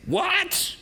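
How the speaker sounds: high gossiping squeak of What; shocked gossip on the phone; mock disbelief. Gossiping to mutual friends about a friend, high mock gasp. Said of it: "you call your mutual friends".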